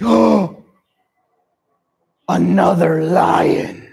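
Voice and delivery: loud shocked cry of discovery; startled shout. Acted. Lion spotting a rival in the well, startled shout. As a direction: gasp then call it out in alarm. Lion sees reflection again.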